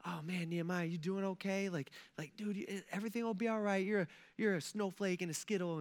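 soft, soothing coddling, played for laughs; mock comforting. Pretend king consoling Nehemiah, soft mock comfort. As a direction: pretend to console a fragile friend, quietly. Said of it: "this is not a comforting thing".

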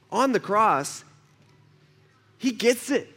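usual speaking voice

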